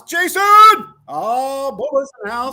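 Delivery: high, loud happy call; loud welcoming shout. Real, not acted. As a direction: hail a friend across the room. Greeting friend arriving loudly.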